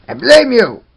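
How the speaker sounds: sudden high loud accusing shout; mock blame. Reviewer blaming the screeching toy parts, mock angry shout. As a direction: yell it at the toy, hammed-up.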